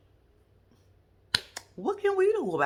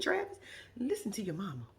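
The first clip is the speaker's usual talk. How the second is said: normal talking voice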